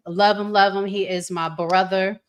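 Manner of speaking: normal talking voice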